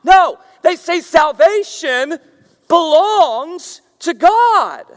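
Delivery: slow, high, shouted proclamation; preaching shout. Real, not acted. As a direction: cry it out to the whole church. Preacher proclaims opening line.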